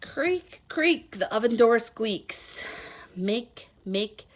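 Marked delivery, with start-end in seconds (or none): none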